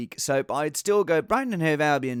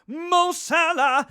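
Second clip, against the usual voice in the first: hyped name call; 13 semitones higher, 5 dB louder, much slower than usual. Acted. Hyping the star player's name.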